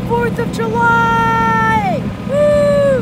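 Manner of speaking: slow, drawn-out loud cheer. Acted. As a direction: shout it out in celebration. Celebratory cheer at fireworks.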